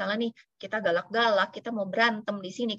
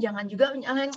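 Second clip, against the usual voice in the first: ordinary talk, nothing marked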